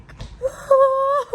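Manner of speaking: high breathless laugh of relief; shaky nervous laugh. Real, not acted. Relief after the snake scare.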